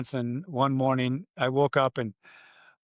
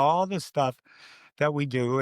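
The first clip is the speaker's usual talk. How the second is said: usual speaking voice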